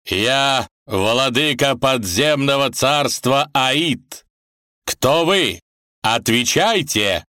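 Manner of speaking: slow, thundering god of the dead; booming voice. Acted. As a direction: boom it out like a ruler. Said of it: "Великан прогремел".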